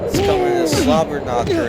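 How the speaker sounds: high excited announcer call; Jim Ross call. Retold or imitated. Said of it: "as Jim Ross would say".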